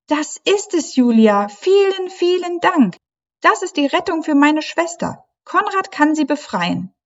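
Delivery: hohe, jubelnde Mädchenstimme voller Dank; erleichterte Meerjungfrau. A narrator's reading. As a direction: die gerettete Freundin, überglücklich und hoch. Leilani relieved and thankful. (hohe, erleichtert jubelnde Mädchenstimme)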